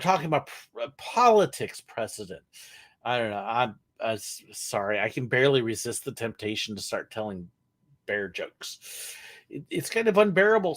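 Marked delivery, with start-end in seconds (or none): none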